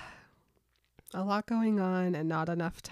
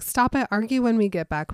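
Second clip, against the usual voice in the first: loud, higher exasperated command; exasperated order; 3 semitones higher, 8 dB louder than usual. Retold or imitated. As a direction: break up the fight. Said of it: "Bella's just like".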